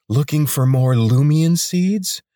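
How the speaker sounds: slow, loud and taunting; a guard's sly taunt, drawn out and loud; mocking challenge. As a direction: drag it out to catch him in the act. Lena catches Corvin sneaking.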